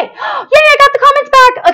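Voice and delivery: high gasp into a cheer; excited relief. Real, not acted. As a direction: squeal it with delight. Comments return after technical trouble.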